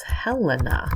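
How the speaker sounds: Helena as HEL-en-ah, slowly; careful pronunciation. Shown as a demonstration. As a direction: stress the first syllable of "Helena", syllable by syllable. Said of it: "It's my name with an A".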